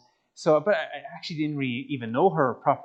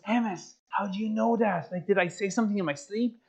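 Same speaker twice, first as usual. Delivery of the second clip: higher-pitched imitation of a girl; put-on girlfriend voice, taken aback; girlfriend caught out; 6 semitones higher than usual. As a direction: voice her surprised, high questioning.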